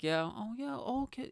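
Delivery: mock the too-patient British listener; a light put-on polite British voice; polite Brits. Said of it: "They'll be just like".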